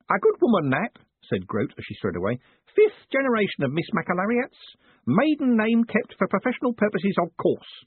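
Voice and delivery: put-on high, eager old man voice; old postman. A narrator's reading. Old Groat chatting to his boss, in a high eager voice. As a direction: chatter like a fussy old clerk.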